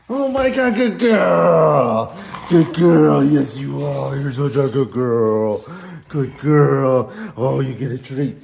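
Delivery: loud overjoyed praise; excited praise. Real, not acted. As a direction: cheer the dog on loudly. Dog succeeds, big praise.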